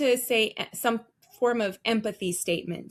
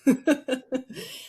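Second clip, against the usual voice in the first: light laugh; 4 semitones higher than usual. Real, not acted. Laugh during talk.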